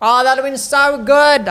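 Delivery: real frustrated yell at the game; frustrated outburst. Real, not acted. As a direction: yell it out, furious at the missed chance. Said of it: "I'm so mad about that".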